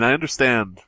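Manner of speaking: ordinary talk, nothing marked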